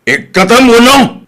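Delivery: loud, high, slow command; angry order. Acted. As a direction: spit out the order furiously. Quarrel over parking spot. (nakapungtot a bilin)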